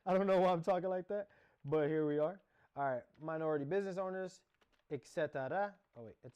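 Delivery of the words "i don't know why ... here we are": louder, laughing through the words. Real, not acted. Amused at her own voice to viewers, laughing louder.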